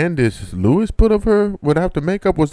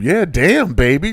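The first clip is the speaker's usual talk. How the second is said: ordinary talk, nothing marked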